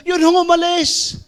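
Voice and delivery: put-on high, timid girl's voice; girl's small voice. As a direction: shrink into a scared teen. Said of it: "girl's reply". (mataas at mahiyaing boses ng dalagita)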